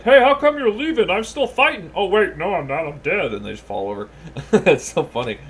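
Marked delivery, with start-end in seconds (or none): 0.0-1.9 high, loud mock protest; protesting victim. Voicing enemies the barbarian walks away from, high mock protest. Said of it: "and they're all like". 1.9-3.3 higher mock dawning realization, easing down; victim realizing. Voicing an enemy who notices he is dead, mock realization. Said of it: "and they're all like".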